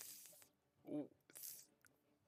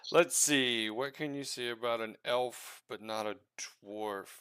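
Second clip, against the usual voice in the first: ordinary talk, nothing marked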